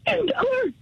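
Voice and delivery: high, tearful yell; crying shout. Real, not acted. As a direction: yell it out in tears. Heated phone breakup argument.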